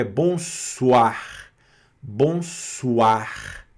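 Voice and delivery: "bonsoir" slowly, as bon-swaar; oi pronounced wa. Shown as a demonstration. Teacher applies the new rule to the word, oi pronounced wa. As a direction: draw out the "wa" in "bonsoir". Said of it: "vira uá".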